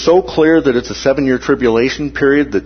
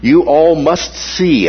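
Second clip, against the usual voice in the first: slow and higher, leaning on see; slow, raised, insistent you all must see; emphatic see; 5 semitones higher, 4 dB louder, slower than usual. Shown as a demonstration. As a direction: press every word of see as a command. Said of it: "the meaning of the Greek here is literally".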